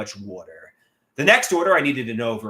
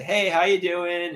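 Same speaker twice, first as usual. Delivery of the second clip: slow, smooth, easy greeting; a slow, suave hello; suave and cool; 5 semitones higher, slower than usual. Shown as a demonstration. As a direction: play it cool and unhurried. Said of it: "try to be suave and cool".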